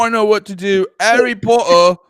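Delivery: loud, hammed-up British accent; broad mock-English author voice; J K Rowling. Author decides how to tell Harry's story, broad British accent. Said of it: "This is what J K Rowling sounds like".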